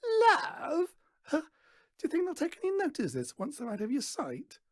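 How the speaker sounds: very high, loud jeering outburst; scornful mockery. A narrator's reading. Daughter mocking Stan's promise, shrill fast jeer. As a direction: shriek it in contempt, then rattle on fast. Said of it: "She reads it and snorts".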